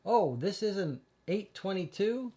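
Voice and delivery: a playful Texas twang; Texas accent. Pretend. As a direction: caller keeps up his fake Texas accent on the phone. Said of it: "I put on my best Texas accent".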